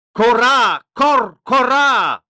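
very high, full-force raven cry; loud warning call. A narrator's reading. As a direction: caw the alarm out as loud as you can. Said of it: "so laut er konnte, seinen Warnruf".

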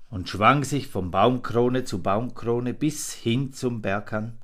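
ordinary delivery

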